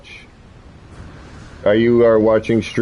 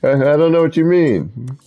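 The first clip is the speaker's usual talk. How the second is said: ordinary talk, nothing marked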